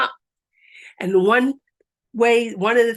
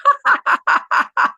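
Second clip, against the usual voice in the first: big high laughing burst; high forced laugh; 6 dB louder than usual. Shown as a demonstration. Instructor finishing the laughter round, high loud laugh. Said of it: "Three times".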